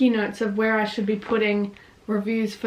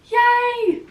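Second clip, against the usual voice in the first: high, loud happy yay; excited cheer; 13 semitones higher, 9 dB louder than usual. Real, not acted. Telling viewers about her new book, cheering loudly.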